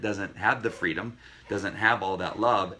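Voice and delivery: ordinary talk, nothing marked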